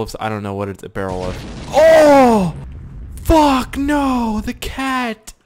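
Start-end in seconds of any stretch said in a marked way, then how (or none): 1.7-5.3 loud high cry of dismay; real frustrated shout; anguished shout. Real, not acted. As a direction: yell out as the cat dies. The cat dies again.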